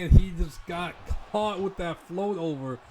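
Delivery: loud, excited play-by-play call; wrestling commentator. Acted. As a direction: hype up the big moment ringside.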